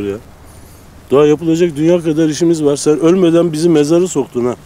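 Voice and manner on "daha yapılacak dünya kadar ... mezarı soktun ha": higher-pitched, remonstrating with a friend; pitched-up friendly objection; lively protest. Acted. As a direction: protest in a raised pitch, pushing back on gloom. Teasing protest at doctor's gloom.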